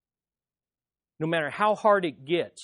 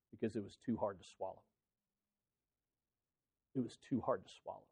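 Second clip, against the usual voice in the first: much softer, fast, dropping low; hushed repeat; 7 semitones lower, 13 dB quieter, faster than usual. Real, not acted. Preacher echoing the crowd's reason, hushed and low. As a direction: let it fall away to a hush.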